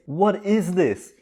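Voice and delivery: ordinary delivery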